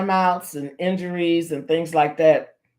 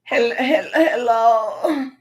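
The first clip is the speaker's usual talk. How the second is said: weak, feeble, put-on hurt voice; a faked ailing hello, slightly higher; fake sick voice; 4 semitones higher, 3 dB louder, much slower than usual. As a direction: pretend you've fallen and can't get up. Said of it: "That was my sick voice, like I was hurt".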